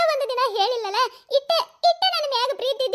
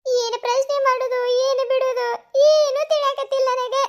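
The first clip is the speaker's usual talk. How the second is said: tearful wailing; 4 dB louder than usual. Acted. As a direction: cry that you are utterly lost. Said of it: "ಮೊದ್ಲ ಅಳುದ್ ನಿಂದ್ರಿಸು".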